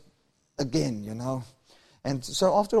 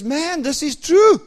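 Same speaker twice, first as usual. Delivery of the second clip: driver preaching; 7 semitones higher, 5 dB louder, slower than usual. Retold or imitated. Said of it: "Preaching to me now".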